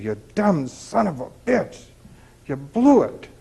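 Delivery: imitating his angry yell at the TV contestant, mildly; a mild mock snap at the screen; mock angry outburst. Retelling yelling at a game show loser, mild mock anger. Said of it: "And I got mad".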